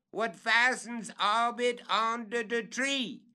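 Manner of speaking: calling down. Acted. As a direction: describe the nail from the limb.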